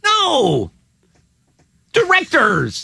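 high, exasperated outburst; exasperated, high-pitched call; exasperated correction. Real, not acted. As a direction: pitch it up in exasperation at a wrong guess. Exasperated at wrong guess.

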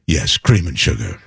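a hint of Bob Guccione's languid reply; Guccione's way of saying it. Guccione answering the host, low mock imitation. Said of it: "but he said it like".

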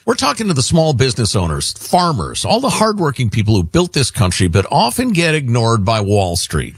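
brisk, upbeat sponsor copy; ad read. Acted. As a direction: pitch the listeners a product. Host reads sponsor ad.

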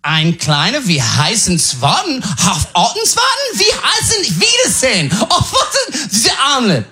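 high-pitched fake German, climbing as it goes; mock German gibberish. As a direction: bluff confidently in made-up German sounds. Bluffs fake German at the audition.

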